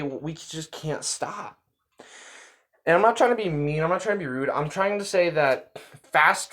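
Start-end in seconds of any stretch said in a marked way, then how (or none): 0.0-1.6 soft, slow, defeated admission; quiet helpless confession. Retold or imitated. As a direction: say it softly and slowly, giving in. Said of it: "some people say".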